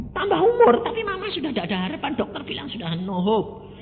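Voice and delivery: high and fast, the sister's hurried answer. Retold or imitated. Older sister on the phone gives up hope, high and rushed. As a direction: rattle it off like the worried sister.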